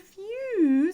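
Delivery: high, loud sigh of relief; relieved phew. A narrator's reading. Dog relieved after the rescue, loud phew. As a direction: blow it out in relief. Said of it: "said the dog".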